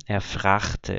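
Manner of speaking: "fragte" said with a throaty ch in place of the g; regional fragte. Shown as a demonstration. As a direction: soften the g of fragte to ch. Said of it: "em vez de dizer fragte".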